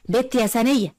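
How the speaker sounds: a high call across the house. Acted. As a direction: summon the maid, voice rising. Mistress calls the maid.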